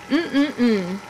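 usual speaking voice

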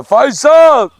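loud call across the garden; calling the donkey from afar. Real, not acted. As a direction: shout his name to bring him over.

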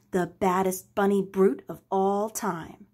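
usual speaking voice

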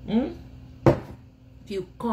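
ordinary talk

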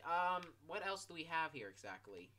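usual speaking voice

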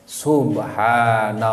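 "Subhanallah" drawn out slow and low; low, level, lengthened "Subhanallah"; dhikr intonation. Shown as a demonstration. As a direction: intone "Subhanallah" slowly as remembrance of God. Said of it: "intonasina ulah jiga nu ngabejaan".

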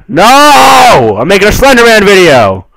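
high, loud hammed-up protest; playful fake panic; mock outraged shout. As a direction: yell it for the video. Mock protest to Slender Man.

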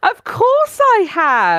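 high, drawn-out sing-song boast; gleeful announcement. Real, not acted. Host asked whether she brought jokes, showy high-pitched glee. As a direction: stretch it out high with showy pride. Mock-grand answer about bringing jokes.

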